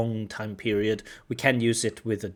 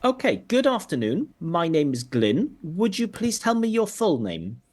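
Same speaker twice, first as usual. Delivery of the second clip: higher, louder, measured examiner voice; formal examiner voice; 7 semitones higher, 4 dB louder than usual. Acted. Examiner greets the candidate, formal and higher. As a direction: open the test like a formal examiner. Plays the IELTS examiner role.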